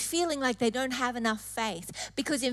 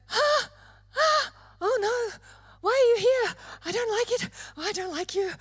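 high, frightened whimpering at the intruder; panicked fretting; 8 semitones higher than usual. Acted. Believer panicking at the enemy's whisper, high frightened whine. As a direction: whine it out like you're scared of a shadow.

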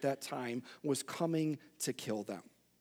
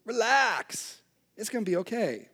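higher, airy chill-out voice; laid-back Moses; 6 semitones higher, 3 dB louder than usual. Retold or imitated. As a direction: brush off the panic like a hippie. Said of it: "Moses stands up and he says".

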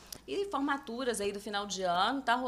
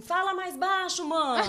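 mild mock yell, higher and a bit slow, ending in a laugh; retold shout; 7 semitones higher, 4 dB louder, slower than usual. Mother imitates daughter yelling from her room, mild mock yell. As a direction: call out like the annoyed daughter, half laughing. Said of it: "ela dá um grito assim".

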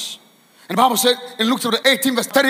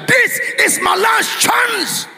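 slow, high preacher's shout; desperate cry; 12 semitones higher, 4 dB louder, much slower than usual. Acted. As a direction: cry out each word as a last plea. Voices desperate Bartimaeus crying out.

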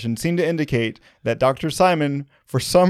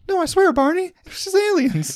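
much higher falsetto pleading; mock Betty. Betty swearing to Barney about aliens, high falsetto plea. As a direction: plead in a squeaky wife voice.

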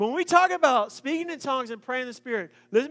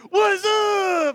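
high, loud, playful yell; mock shout; 9 semitones higher, 7 dB louder than usual. Person in heaven posing as Jesus, loud mock shout. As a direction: holler it like a dude-bro.

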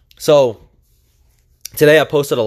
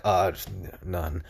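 embarrassed mumble; 8 semitones lower, 11 dB quieter, slower than usual. Retold or imitated. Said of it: "getting that question was so embarrassing".

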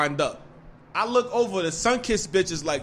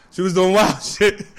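laughing; 5 dB louder than usual. Real, not acted. Laughs while recounting her antics.

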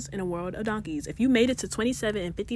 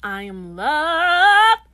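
proclaimed affirmation; 7 semitones higher, 12 dB louder, much slower than usual. Acted. Dramatic affirmation, drawn out.

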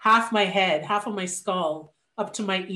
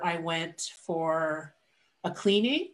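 ordinary talk

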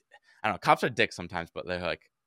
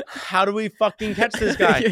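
high, slow, louder lament; exasperated panic; 8 semitones higher, 5 dB louder, slower than usual. Retold or imitated. Bike owner asking how to catch thief, exasperated retelling. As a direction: draw out the helpless question, half amused. Retold despair at moving thief.

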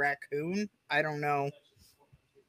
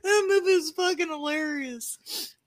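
laughing; 10 semitones higher than usual. Real, not acted. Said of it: "repeated, laughing high".

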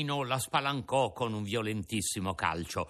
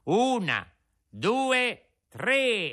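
loud, higher count, climbing on each number; counting aloud; 9 semitones higher, 4 dB louder, much slower than usual. Acted. Pinocchio counting to Lucignolo before the caps fly, loud. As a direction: call out the count like a starter. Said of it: "contare a voce alta".

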